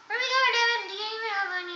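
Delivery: a hint of a whiny companion; questioning candlestick. Candlestick asks David where they are going.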